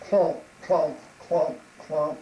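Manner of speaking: low, loud, weighty thuds; big beast's booming footfalls; heavy clomping steps. Acted. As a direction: stomp out each word.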